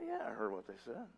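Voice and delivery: low, very soft retold answer; muted, low-key coach's reply; understated reply. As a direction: answer quietly, playing it right down.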